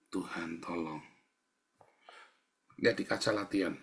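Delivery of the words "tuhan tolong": low, soft plea for help; furtive little prayer. Shown as a demonstration. Preacher models praying when trouble comes, low and soft. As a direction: slip in a quiet plea. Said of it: "kamu ngelirik".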